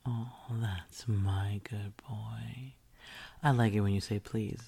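usual speaking voice

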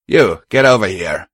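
loud brisk order; brisk demand. A narrator's reading. Gang leader orders the mare over, demanding briskly. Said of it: "he demanded briskly of the mare".

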